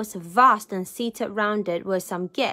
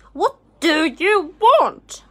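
loud, slow, high-pitched rude demand; 7 semitones higher, 9 dB louder, slower than usual. A narrator's reading. Rich man opening his door to a stranger, rude demand. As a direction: demand it rudely, punching each word. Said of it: "he demanded rudely".

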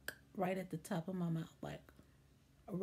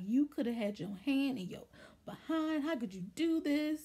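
higher-pitched retold outrage; mom freaking out; 5 semitones higher, 3 dB louder than usual. Furious mom scolding the doctor, retold outrage. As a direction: scold in imitated disbelief, pitch climbing. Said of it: "she was freaking out".

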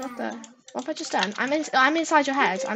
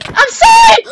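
very high, shouted mock apology; exaggerated sorry; 13 dB louder, slower than usual. Told to mean the apology, player shouts it. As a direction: yell it as if you mean it. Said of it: "Say like you mean it".

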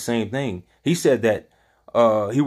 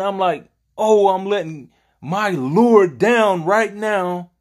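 higher-pitched, regretful disbelief, acted out; anguished regret; 7 semitones higher than usual. Preacher voicing Peter after denying Jesus, anguished regret. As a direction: preach Peter's agonized self-reproach in a raised pitch.

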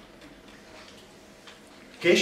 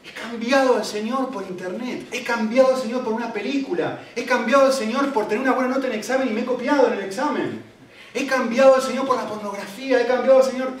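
anguished confession; 6 semitones higher, 5 dB louder than usual. Acted. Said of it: "Y digo".

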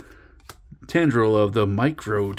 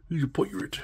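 lightly voiced pirate, higher and softer; mock pirate; 6 semitones higher, 7 dB quieter than usual. Joking over a goblin pirate card, a hint of pirate voice. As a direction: toss in a little pirate voice.

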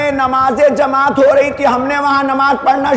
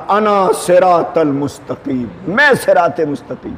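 lightly voiced Ali's declaration; 7 semitones lower than usual. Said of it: "علی نے سینے پہ ہاتھ مار کے کہا تھا".

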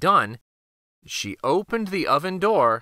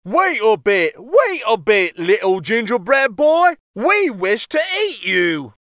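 louder calling voice; mowers calling out; 6 semitones higher, 5 dB louder than usual. A narrator's reading. Mowers calling after the cookie, loud call. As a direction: call after him loudly. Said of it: "calling out".

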